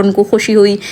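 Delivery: ordinary delivery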